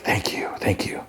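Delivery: retold whisper of relief; whispered thanks. Alone after the call, he whispers his thanks. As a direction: breathe it out in a whisper.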